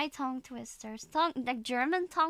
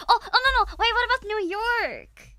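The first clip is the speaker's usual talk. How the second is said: high, loud burst of a new idea; excited; 9 semitones higher, 9 dB louder than usual. Real, not acted. Suddenly proposing New York as the next accent, loud and excited. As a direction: blurt it out excitedly.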